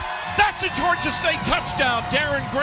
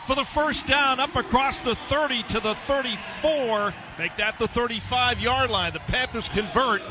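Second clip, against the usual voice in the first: excited play-by-play; 5 semitones higher than usual. Acted. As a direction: pitch jumps up on the completion. Play-by-play rising to a first-down call.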